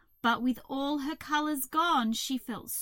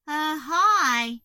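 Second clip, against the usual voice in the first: loud, higher glum greeting; forlorn cockroach; 4 semitones higher, 12 dB louder than usual. A narrator's reading. Cockroach answering Hazel, forlorn. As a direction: moan a hesitant hello. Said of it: "The cockroach was staring forlornly".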